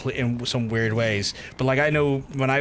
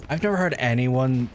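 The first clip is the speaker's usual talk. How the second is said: ordinary delivery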